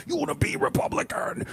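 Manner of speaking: unvoiced, high whisper scream. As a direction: scream it in a whisper so the hotel can't hear. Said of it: "The whisper yelling makes this".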